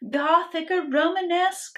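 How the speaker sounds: lively rhyming read-aloud; story narration. A narrator's reading. Reading a rhyming picture book to children, lively narration. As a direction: read it to kids with bounce.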